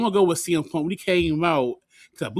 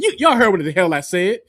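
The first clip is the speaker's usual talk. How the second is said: higher, louder acted comeback; loud mock retort; 6 semitones higher, 7 dB louder than usual. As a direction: imitate a wrestler talking back to the crowd. Acting out wrestler snapping at crowd.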